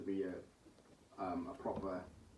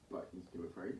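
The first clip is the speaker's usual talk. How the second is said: ordinary talk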